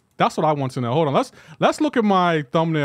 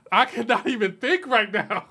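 laughing; 5 semitones higher than usual. Real, not acted.

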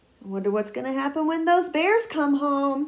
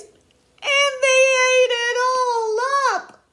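very high squeaky wail; drawn-out squeaky mock crying; Baby Bear; 12 semitones higher, 7 dB louder, slower than usual. A narrator's reading. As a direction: wail it slowly like a tiny upset cub. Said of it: "in a high squeaky voice".

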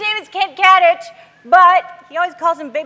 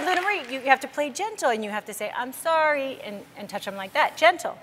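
put-on softly, patiently teaching a toddler; gentle mom voice; 2 semitones lower, 9 dB quieter than usual. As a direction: talk down softly to a two-year-old. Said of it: "so I said".

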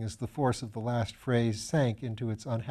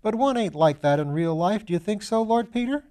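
put-on higher, bright-eyed young medical student voice; eager young student; 8 semitones higher, 6 dB louder than usual. Young Piggott protesting to Lord Peter, higher eager student voice. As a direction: play the eager, slightly foolish young student.

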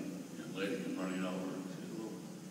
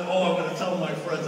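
excited; 10 semitones higher, 12 dB louder than usual. Retold or imitated. Boy excited.

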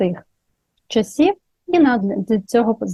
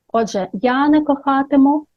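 ordinary talk, nothing marked

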